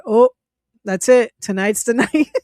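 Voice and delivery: retold last words breaking into real laughter; laughing. Real, not acted. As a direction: crack up while telling it. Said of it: "I'm sorry I'm laughing".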